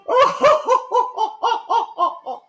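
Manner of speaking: bright high chuckle; high relieved laugh. Acted.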